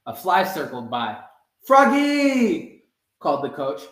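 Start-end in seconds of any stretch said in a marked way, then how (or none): 1.7-2.8 loud high call across the field. A narrator's reading. Coach calls Froggy across the field, loud call. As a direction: call his name from the sideline.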